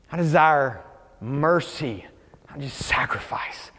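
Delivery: slow and low, fading to a whisper; grave, intimate scripture quote; hushed quotation. Retold or imitated. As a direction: weigh each word softly. Quoting Jesus's saying gravely.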